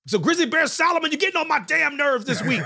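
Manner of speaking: high-pitched comic gripe; exasperated mock scolding; mock exasperation. Mock rant calling out a topic.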